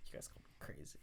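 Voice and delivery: low whispered taunt; creepy whisper. Acted. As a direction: whisper it like a madman. Whispered joke before elephant attempt.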